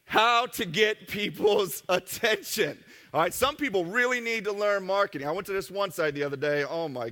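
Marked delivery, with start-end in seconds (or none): 0.0-2.8 slow, louder, laugh breaking mid-phrase; laughing while reading. Real, not acted. A presenter to the audience, still laughing over the slide. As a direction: read the line, losing it to a laugh.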